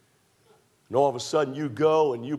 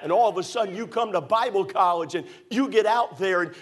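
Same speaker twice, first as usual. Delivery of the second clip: preaching; 7 semitones higher than usual. Acted. Preacher urging young people to serve God, full-force preaching. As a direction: lift the crowd with the call.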